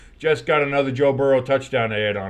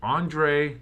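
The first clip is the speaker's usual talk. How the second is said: normal talking voice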